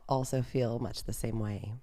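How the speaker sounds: ordinary delivery, nothing marked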